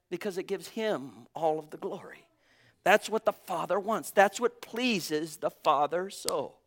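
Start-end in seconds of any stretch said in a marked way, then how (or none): none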